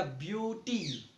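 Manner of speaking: ब्यूटी drawn out slowly and softly, long ऊ; pronouncing beauty. Shown as a demonstration. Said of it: "उसका क्या हो जाएगा". (ब्यूटी धीरे, लंबे ऊ के साथ)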